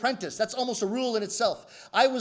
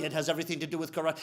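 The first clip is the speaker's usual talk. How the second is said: low, soft, fast rebuttal; unmoved master, quick and low; sensei; 6 semitones lower, 5 dB quieter, much faster than usual. Acted. Sensei contradicting his student, low quick reply.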